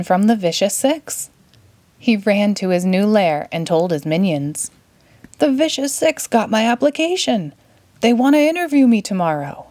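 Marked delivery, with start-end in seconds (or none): 5.4-9.7 lightly voiced Gru, higher and eager, faster at the end; a hint of a boyish villain bursting with news; young Gru. A narrator's reading. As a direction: read Gru's lines a bit higher and quicker. Gru tells minions his news.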